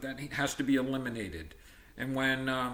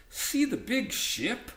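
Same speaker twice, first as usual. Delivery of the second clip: high, slow excited call; teacher voicing Sam as he spots the ship; 12 semitones higher, 3 dB louder, slower than usual. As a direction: point it out with wonder in a much higher voice. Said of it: "asked Sam".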